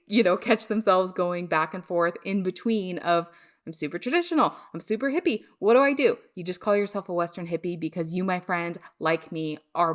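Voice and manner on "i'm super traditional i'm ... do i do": lightly acted, higher-pitched dilemma; torn inner voice. Host imitating a listener caught between two sides, lightly torn. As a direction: voice someone mildly torn between two identities.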